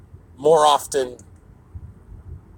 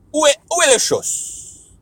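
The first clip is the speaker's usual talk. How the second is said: dramatic French; 14 semitones higher than usual. Pretend. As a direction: ham up the French. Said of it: "when I talk another language".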